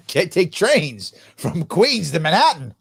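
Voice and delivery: usual speaking voice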